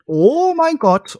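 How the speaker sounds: high, slow shriek of disbelief; shrieking jackpot winner. Real, not acted. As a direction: shriek it out like you just won big. Shocked at googled stats.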